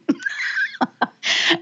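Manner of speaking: a long high burst of real laughter. Real, not acted. Host laughing at her own line to the caller, a long high laugh.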